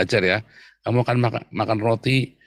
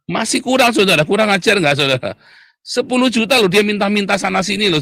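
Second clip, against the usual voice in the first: higher and louder in real irritation; annoyed outburst; 8 semitones higher, 5 dB louder than usual. Real, not acted. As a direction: fire it out, fed up with the greedy beggar. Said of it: "sampai saya jengkel". (meluapkan kejengkelan dengan suara tinggi dan keras)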